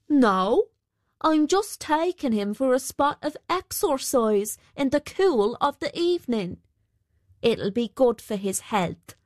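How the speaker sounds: high, lilting weaver fobbing off the miller; cheeky weaver character. Acted.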